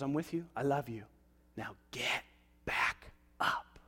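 gentle voice of God. Acted. God comforting the believer, low and soft. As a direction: speak like a tender father.

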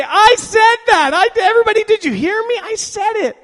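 mock boasting. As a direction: crow to everyone like a show-off. Boasting after prayer worked.

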